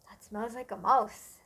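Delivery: sniffing cat. Acted.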